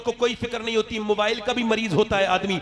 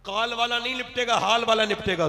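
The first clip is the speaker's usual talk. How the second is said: ordinary talk, nothing marked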